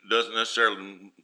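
ordinary talk

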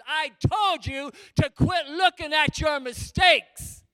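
slow, loud fatherly rebuke; in-your-face shout. Retold or imitated. God as a father scolding him to his face, slow loud shout. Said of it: "he was in my face".

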